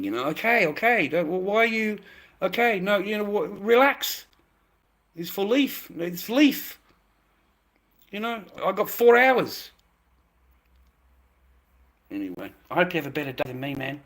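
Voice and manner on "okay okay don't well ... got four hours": much higher, louder placating pleading, okay over and over; mock simpering appeasement of an angry wife; simping. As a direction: grovel to calm her down, hands up. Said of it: "really simping".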